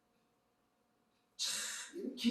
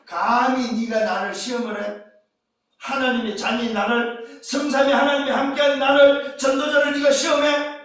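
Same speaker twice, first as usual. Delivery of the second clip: acted challenge, building higher and louder; indignant rebuke; 8 semitones higher, 11 dB louder than usual. Believer confronting the devil, indignant rebuke. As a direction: build to an offended demand.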